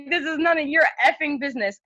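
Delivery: mock a defiant student telling her off; defiant retort. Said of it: "I'm like".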